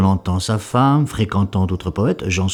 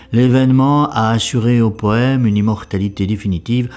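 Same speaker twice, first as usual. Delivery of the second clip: normal talking voice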